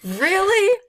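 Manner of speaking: high-pitched, hammed-up disbelief; mock incredulous. Actress replaying her reaction to a character, incredulous.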